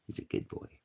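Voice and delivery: whispered aside. Real, not acted. Whispering about his dog, unvoiced. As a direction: whisper it so the dog won't hear. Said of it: "in front of my dogs".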